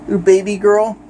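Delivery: ordinary delivery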